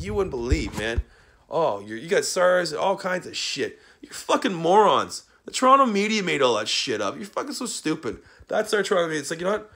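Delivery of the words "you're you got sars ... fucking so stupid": normal talking voice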